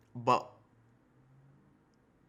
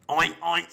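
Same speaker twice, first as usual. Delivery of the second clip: louder mock oink, oink; pig oinks; 3 semitones higher, 7 dB louder than usual. Reactor mimics the boar, loud oinks. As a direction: snort like a pig. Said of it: "That's how they sound".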